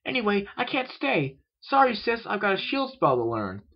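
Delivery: high, loud filly voice; excited shout. A narrator's reading. Filly shouting to her sister as she runs out, excited. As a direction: call it out eagerly while leaving. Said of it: "Philly shouted in excitement".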